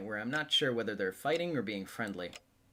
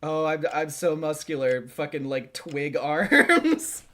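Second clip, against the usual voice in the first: mocking while laughing; 6 semitones higher, 11 dB louder, slower than usual. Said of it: "what's it like".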